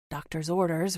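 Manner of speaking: ordinary delivery, nothing marked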